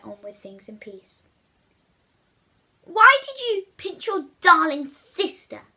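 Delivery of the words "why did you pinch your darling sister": high, loud demanding mother. Acted. Mother demanding answers from William, loud and high. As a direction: scold him like a furious mum. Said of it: "Mother would demand".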